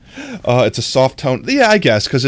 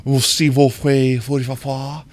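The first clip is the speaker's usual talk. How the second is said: mock French; 2 semitones higher than usual. Someone coming up to woo the streamer, mock-French accent. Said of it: "someone came up to me and was like".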